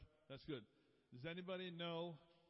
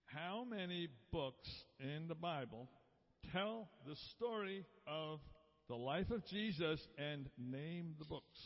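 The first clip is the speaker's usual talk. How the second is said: deliberate quiz question in spaced phrases; measured quizmaster delivery; slow question reading. Real, not acted. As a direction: read it out slowly, pausing between phrases. Quiz question read out, first time.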